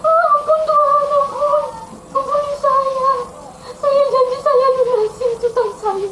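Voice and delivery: high-pitched, tearful begging; acted crying plea. Weeping servant promising the king to repay, high and pleading. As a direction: wail and plead, hammed-up. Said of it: "hamba ini menangis".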